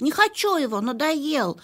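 high, pouting whine; whiny refusal. Acted. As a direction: pout and refuse. Dog voiced refusing.

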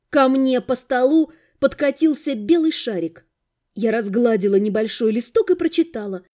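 usual speaking voice

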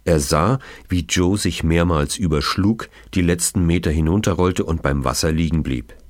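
ordinary delivery

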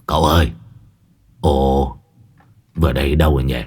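put-on stammering old monk. Môn Phọt gọi với theo cậu, giọng trầm lắp bắp sợ hãi. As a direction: plead after him, tripping over words.

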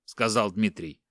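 plain reading voice

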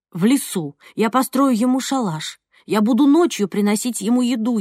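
ordinary delivery, nothing marked

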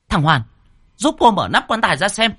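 high-pitched, urgent shout; loud shouted order. A narrator's reading. Aunt orders Hoàn to open the coffin, shouting. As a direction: call out a command across the room. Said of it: "Cô quay lại quát to".

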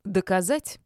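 loud, heated challenge; losing temper. A narrator's reading. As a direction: dare her, losing your temper. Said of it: "психанула я". (психанув, с вызовом)

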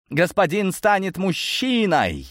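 high, slowed, jubilant announcement; rejoicing. A narrator's reading. Bodyguards celebrate their young master, rejoicing. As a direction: proclaim it high, drawing out the key word. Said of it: "возрадовались охранники".

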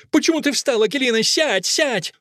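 high, agitated cry; urgent, high-pitched demand; Fusia. A narrator's reading. Fusia stops Akelina from leaving, crying out high. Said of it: "кричит Фусия".

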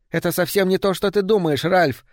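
high-pitched woman protesting; anxious denial. A narrator's reading. Woman explaining herself to Ralph, anxious denial. Caught woman pleads with her lover.

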